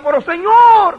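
very high, loud acted plea; desperate cry. Pastor crying to God as the pains continue, desperate cry. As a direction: cry out in protest to heaven.

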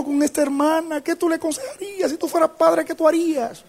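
high, climbing, insistent appeal; impassioned pleading. Retold or imitated. Young pastor asks God what He would do, impassioned high plea. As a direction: re-enact begging God for an answer, pitch climbing.

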